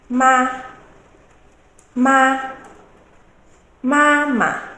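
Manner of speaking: "ma" held high and level; high, even Mandarin first tone on "ma"; flat tone. Shown as a demonstration. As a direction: hold "ma" on one high, loud, level note. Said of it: "with a flat tone".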